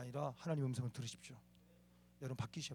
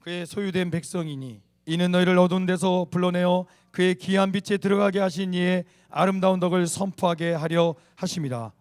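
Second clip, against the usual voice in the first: loud, slow, measured group-reading voice; scripture recitation; 2 semitones higher, 13 dB louder than usual. Real, not acted. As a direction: read the verse out loud and steadily for all to follow.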